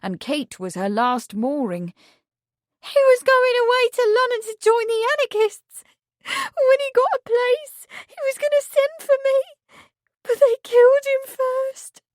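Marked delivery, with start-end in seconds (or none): 2.8-12.0 put-on high, tearful servant girl voice; a young maid choking on grief; high-pitched grieving girl. Meg's grieving voice.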